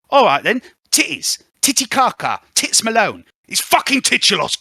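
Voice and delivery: put-on high, loud cockney girl snapping at the radio; squeaky street urchin, loud and rude; Spring's voice. Spring griping at the truck radio, high and loud.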